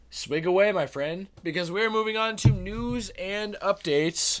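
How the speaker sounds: high, showy show-host intro; announcer voice. Acted. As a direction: bring in the next segment like a host. Introducing the news segment.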